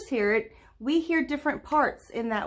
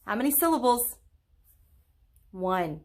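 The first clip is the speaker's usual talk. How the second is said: ordinary delivery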